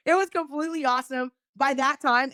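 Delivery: ordinary delivery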